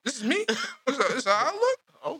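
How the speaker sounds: high, wondering voice at its own face; amazed robot. Retold or imitated. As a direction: marvel at your own reflection. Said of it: "robot sees reflection".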